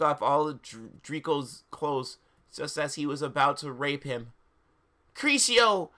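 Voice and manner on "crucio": high loud cast of a curse; hammed-up spell cry; spell shout. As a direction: fling the curse out. Casting a spell in the story.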